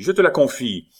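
loud, high urgent entrusting; shouted. A narrator's reading. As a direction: call it out over the fight. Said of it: "cria Bussy".

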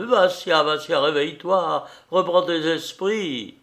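urgent plea through acted tears; tearful cry. A narrator's reading. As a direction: cry out to rouse a friend. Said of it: "criait à travers ses larmes".

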